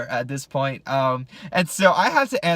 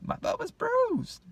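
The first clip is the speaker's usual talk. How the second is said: squeaky aching voice; 12 dB quieter, slower than usual. Real, not acted. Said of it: "bruised butt".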